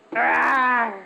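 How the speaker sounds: loud playful dinosaur roar. Shown as a demonstration. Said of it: "You can do your roar".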